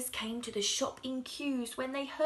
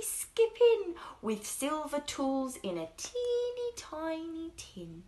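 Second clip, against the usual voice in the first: usual speaking voice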